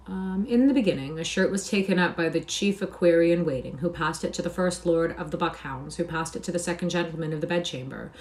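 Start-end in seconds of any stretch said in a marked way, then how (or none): none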